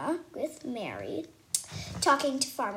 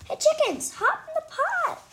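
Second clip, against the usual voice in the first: much higher, loud yell at the chickens; 5 dB louder than usual. A narrator's reading. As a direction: shout it while chasing the chickens. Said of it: "chases the chicken and yells".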